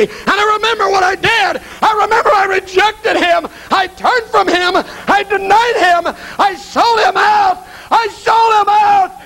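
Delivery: high, anguished wail, words stretched; frantic, high-pitched torment; tormented shouting. Acted. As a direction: cry out in panic and remorse. Judas tormented, wolves coming.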